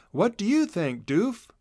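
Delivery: a hint of a big brother asking; young Chisel. A narrator's reading.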